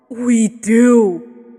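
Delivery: a loud, earnest entreaty; entreating. Acted. Man entreats Abel, loud and earnest. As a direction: urge him to believe them. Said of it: "the man entreated".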